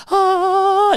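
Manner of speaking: put-on high, loud shaky wail of a hurt kid; child's shaky cry. Father imitating his hurt son after the crash, high shaky wail. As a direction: let out a hurt little boy's trembling cry. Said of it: "like, like that".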